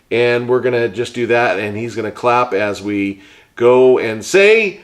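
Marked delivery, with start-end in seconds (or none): none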